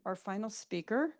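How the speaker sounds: ordinary delivery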